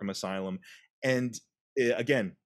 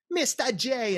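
put-on high, sharp Brooklyn Harley accent on "Mister J"; Harley's Brooklyn accent; 14 semitones higher, slower than usual. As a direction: lean hard into the Brooklyn lilt on "Mister J". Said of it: "sharper accent".

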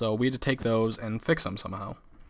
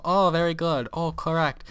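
higher mock Chinese-accented teacher; imitated Chinese accent, pitched up; Chinese accent impersonation; 6 semitones higher than usual. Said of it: "that is really how she sounds".

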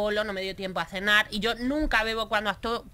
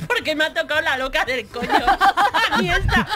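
exasperated cop; 5 semitones higher, 8 dB louder than usual. Retold or imitated.

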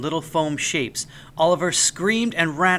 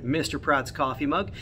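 plain reading voice

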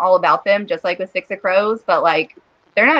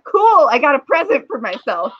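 laughing; 8 semitones higher than usual. Retold or imitated. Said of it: "And I was like".

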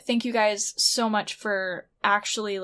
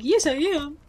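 higher-pitched pretend reply for the dog; playful dog's voice; 6 semitones higher, 4 dB louder than usual. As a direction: voice the dog's answer, pitched up in play. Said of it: "He's like".

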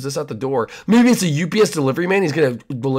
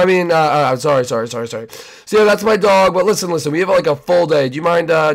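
ordinary delivery

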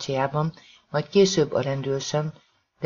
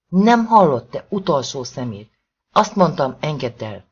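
furious shout; 6 dB louder than usual. Acted. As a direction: tear into him with rage. Rosie attacks Norman, furious.

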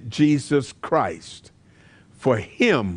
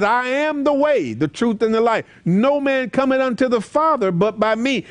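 verse lifted higher and ringing; preached scripture; 9 semitones higher than usual. Retold or imitated. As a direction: proclaim it to the congregation. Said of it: "That's why Jesus says".

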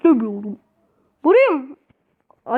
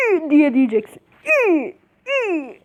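demonstrated "İyi". Shown as a demonstration. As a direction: stretch "İyi" into a rising and falling squeal. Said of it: "Bak öyle demeyeceksin".

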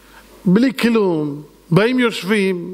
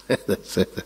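low mocking laugh; 12 semitones lower, 7 dB quieter than usual. Mocking laugh after quote.

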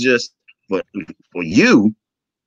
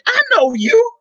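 high, louder burst of recognition; surprised recognition; 4 dB louder than usual. Retold or imitated. Man spotting a face he knows, surprised recognition. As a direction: act out suddenly recognizing someone.

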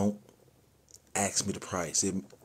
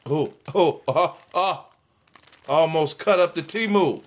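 sudden loud alarmed oh oh, higher; startled; 6 semitones higher, 8 dB louder than usual. Real, not acted. Cutting open the package, a startled yelp. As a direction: yelp in alarm at a near slip.

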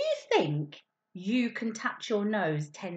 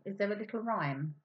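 usual speaking voice

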